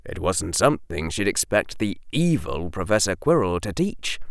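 ordinary delivery

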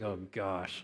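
low, soft, sheepish mutter; mildly pained aside to himself; mock dread. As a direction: drop to an embarrassed murmur, playing it up. Retells dreading next question.

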